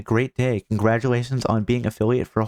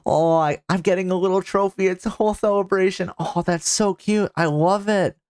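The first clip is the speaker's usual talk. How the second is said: higher, bubbly delight; delighted squeal; 6 semitones higher than usual. Real, not acted. Streamer gushing over fan art of herself, excited and high. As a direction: gush over the little trophy. Thrilled at new trophy art.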